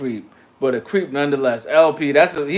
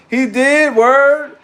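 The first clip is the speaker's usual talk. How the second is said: real surprise; 10 semitones higher, 7 dB louder, much slower than usual. Real, not acted. Reacts to shocking trivia.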